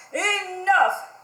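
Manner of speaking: loud, high angry shout; the fed-up king roars enough. Acted. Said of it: "he said".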